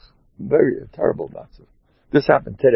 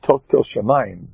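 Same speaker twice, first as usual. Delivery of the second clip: normal talking voice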